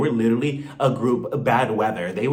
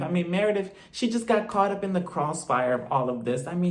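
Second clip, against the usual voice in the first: ordinary delivery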